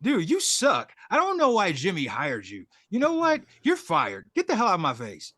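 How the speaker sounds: hammed-up outraged boss, pitch jumping up; mock tirade with high swoops; mock angry firing. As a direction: act out chewing someone out.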